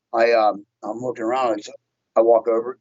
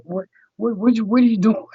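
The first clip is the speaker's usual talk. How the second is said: high, frantic imitation of a panicked friend; mock panicked Bryce; alarmed caller; 11 semitones higher than usual. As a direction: squeak it out like a startled friend.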